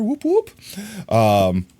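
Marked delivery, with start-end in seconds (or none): 0.0-0.5 high whooping cheer; a quick high celebratory whoop; excited whoop. Real, not acted. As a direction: cheer the new follower in. Cheering new follower.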